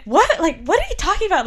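high-pitched, rushed, alarmed question; freaking out. Retold or imitated. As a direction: blurt it out in a panic. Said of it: "I was kinda freaking out".

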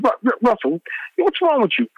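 retold incredulous plea to Russell; exasperated disbelief. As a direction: stumble on his name then shoot up in disbelief. Arguing with Russell.

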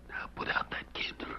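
urgent whisper; hushed order in the dark. Acted. Hiding from gunmen in tunnel.

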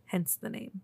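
usual speaking voice

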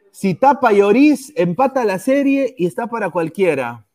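loud hopeful call; tense shout. Real, not acted. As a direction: shout it with rising tension. Tense penalty moment.

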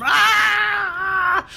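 long high shriek, loud; high screaming cry. Acted. Burning monk running out, long high shriek. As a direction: scream as you burn. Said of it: "you hear the cries of".

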